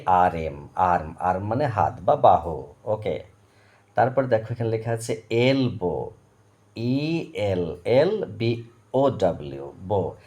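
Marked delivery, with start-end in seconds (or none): none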